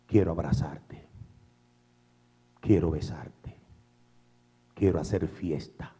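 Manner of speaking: soft whisper of God's love; hushed loving whisper; whispered tender voice. Acted. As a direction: breathe it like a secret promise. Voicing God's loving call.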